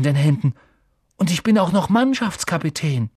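high, loud, proud wonder; amazed excitement. A narrator's reading. Ron sees himself as captain in the mirror, amazed. Amazed at his future self.

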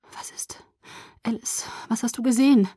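pleading whisper; urgent whisper. A narrator's reading. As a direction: breathe the question anxiously. Urgent question in tense scene.

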